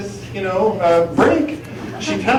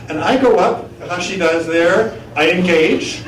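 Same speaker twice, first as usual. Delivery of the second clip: ordinary delivery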